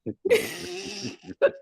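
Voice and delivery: high, suppressed snickering; imitating laughter being held in; stifled laughter. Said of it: "trying not to let out laughter".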